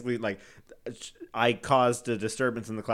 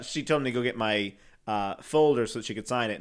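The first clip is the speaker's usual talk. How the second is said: normal talking voice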